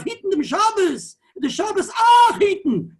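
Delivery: high, loud, slow echo of the rebbe's wail; echoing the rebbe's cry. Retold or imitated. Rabbi repeating the rebbe's words for listeners, high and loud. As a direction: repeat the rebbe's lament, pleading. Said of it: "That's the way he said it".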